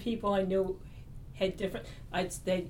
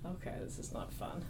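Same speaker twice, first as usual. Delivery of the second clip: deflated retort; 9 dB quieter than usual. Retold or imitated. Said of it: "And I was like".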